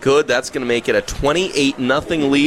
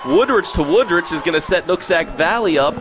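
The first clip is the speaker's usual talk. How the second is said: big-play call, voice raised high in pitch; excited play-by-play; 6 semitones higher than usual. Real, not acted. Live call of a long completion.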